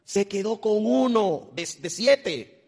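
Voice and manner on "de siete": normal talking voice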